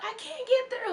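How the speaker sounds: high whiny mock cry; stuck in crowd. Fan stuck in the crowd, squeaky and high.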